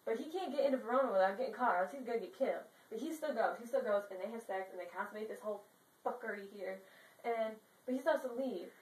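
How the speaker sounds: usual speaking voice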